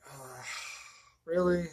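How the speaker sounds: ordinary talk, nothing marked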